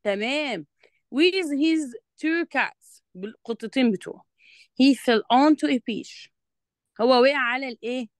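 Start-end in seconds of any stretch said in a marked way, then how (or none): 1.1-3.0 slow reading aloud. Shown as a demonstration. Reading the script aloud slowly. 4.8-6.3 slow, louder dictation of the English line; slow reading aloud. Shown as a demonstration. Reading the script aloud slowly.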